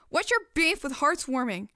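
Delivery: shouting back. Acted. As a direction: throw it right back at her. Daughter shouts back in quarrel.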